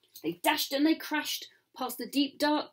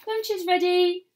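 high calling voice; mother calling from the house; mum calling out; 7 semitones higher, 4 dB louder than usual. A narrator's reading. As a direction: call the child in for lunch. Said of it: "little Jack's mum cried".

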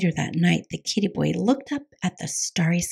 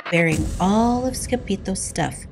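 ordinary delivery

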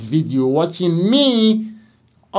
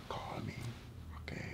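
breathy hushed whisper; soft secretive whisper; 5 semitones lower, 26 dB quieter, faster than usual. Acted. Dramatic hushed aside.

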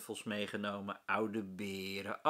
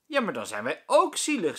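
put-on louder, higher complaining kid; whiny child; 6 semitones higher, 10 dB louder than usual. A narrator's reading. Fatima protests to the teacher, louder child whine.

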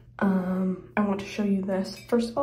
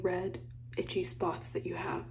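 soft conspiratorial whisper; 5 dB quieter than usual. Real, not acted. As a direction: hush it like a confession. Whispered skincare warning.

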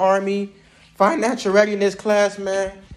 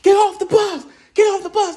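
high yelled order, much higher pitch; drill sergeants yelling; 12 semitones higher, 3 dB louder than usual. Retold or imitated. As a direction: bark it like a drill sergeant off the bus. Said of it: "five drill sergeants yelling".

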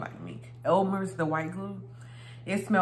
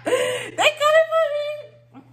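high, slow, hammed-up fake sob; mock crying wail; 6 dB louder, slower than usual. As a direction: wail it like a fake cry, drawn out. Drawn-out mock cry, high.

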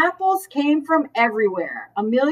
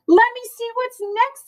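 bright teacher voice; 12 semitones higher than usual. A narrator's reading. Amelia Bedelia reading lesson plan.

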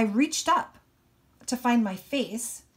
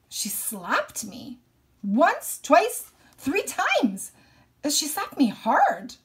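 building intensity; 6 semitones higher, 5 dB louder, much slower than usual. A narrator's reading. Narrating mother slapping him.

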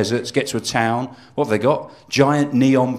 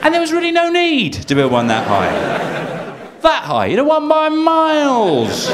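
high, drawn-out mock boasting; squeaky ridiculous bragging; 4 dB louder than usual. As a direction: gloat about the giant thermometer. Mocking the town's pride.

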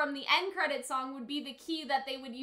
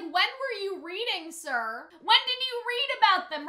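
mock interrogation; 6 semitones higher, 5 dB louder than usual. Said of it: "'Cause I'm like".

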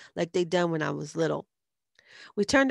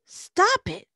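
high, loud protest; yelling; 6 dB louder than usual. A narrator's reading. Liz yelling at a boy throwing eggs, high loud yell. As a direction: yell to make him stop at once. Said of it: "Liz yelled".

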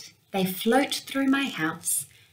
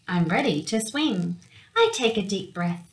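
ordinary delivery, nothing marked

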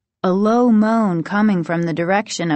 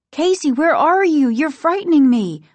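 high, raised anxious call; frightened calling out; 8 semitones higher, 5 dB louder than usual. A narrator's reading. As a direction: call out loudly for a missing brother. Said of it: "Margaret called".

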